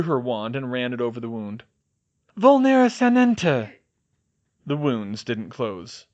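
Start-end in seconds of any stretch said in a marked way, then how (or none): 2.4-3.7 spell incantation. A narrator's reading. Casting a spell.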